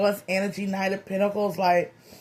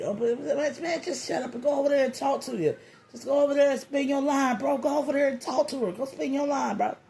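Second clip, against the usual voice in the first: higher, fast-talking buddy egging him on; urging a friend; 5 semitones higher, 3 dB quieter than usual. Acted. Friend tells shy man to go talk to her, urging. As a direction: push him impatiently, pitched up and rapid.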